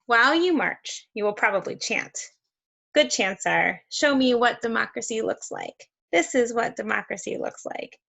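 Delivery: usual speaking voice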